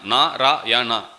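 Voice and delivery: NARAYANA slowly broken into Na, ra, ya, na; syllable by syllable. Shown as a demonstration. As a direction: say "Narayana" slowly with a gap after each syllable. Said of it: "How many syllable?".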